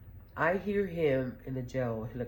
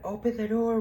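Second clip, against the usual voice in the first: higher and louder command; police order; 8 semitones higher, 6 dB louder than usual. Retold or imitated. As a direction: demand it like a cop. Cops demand again.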